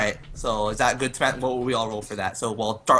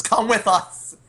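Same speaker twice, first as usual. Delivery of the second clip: urgent high, louder call; raised voice, pitched well up; called-out command; 10 semitones higher, 5 dB louder, slower than usual. Acted. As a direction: call out to a comrade to follow. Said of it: "and says".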